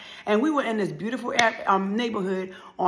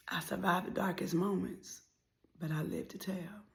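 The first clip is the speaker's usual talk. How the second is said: quiet, poetic murmur; hushed refrain; 10 dB quieter than usual. Acted. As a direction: whisper it as a solemn refrain. Whispered refrain.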